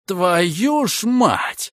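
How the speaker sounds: wheezed, slow hoarse oath; raspy whisper. A narrator's reading. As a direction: rasp it out in shock. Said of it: "просипел комиссар". (хриплым сипом)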